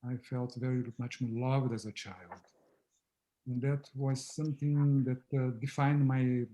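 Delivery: normal talking voice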